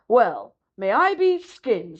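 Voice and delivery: put-on slow, gruff old walrus drawl. A narrator's reading. Drowsy old walrus marvels at the little seal. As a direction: drag it out like a grumpy old walrus.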